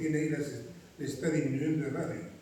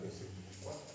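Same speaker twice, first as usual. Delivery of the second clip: a teacher's whispered exam tip; whispered hint; 13 dB quieter than usual. Real, not acted. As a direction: whisper es el cuatro like a secret.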